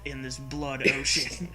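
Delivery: usual speaking voice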